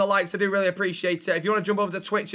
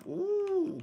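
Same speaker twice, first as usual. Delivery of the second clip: very high, soft "ooh"; intrigued "ooh"; 5 dB quieter than usual. Real, not acted. Streamer hears of AJ's new trainer, intrigued high ooh. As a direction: let out a falsetto ooh.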